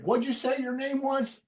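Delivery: put-on high, squinting old-man question; mock the old man's suspicion; suspicious grandpa. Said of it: "Grandpa's like".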